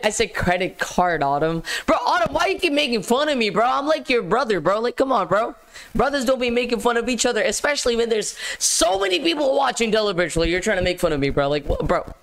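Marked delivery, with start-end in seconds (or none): 1.9-10.5 high, whiny plea to a teasing sibling; playful protest. Real, not acted. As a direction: complain in a raised, wounded voice. Mock complaint at being teased.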